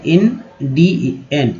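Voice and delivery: "एन डी एन" said as three slow, separate syllables; split into syllables. Shown as a demonstration. Teacher breaking a word into parts for students, slow and syllable by syllable. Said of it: "एक तो तीन बार तोड़ा गया".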